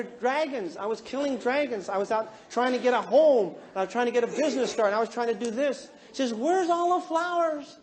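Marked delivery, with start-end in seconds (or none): 6.3-7.8 high, slow, drawn-out complaint; mock plaintive wife. Wife asking husband where romance went, mock-plaintive wail. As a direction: stretch it into a high lament.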